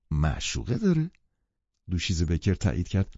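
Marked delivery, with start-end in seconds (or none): 0.1-1.1 mild stunned question. Acted. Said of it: "مات و مبهوت گفتم".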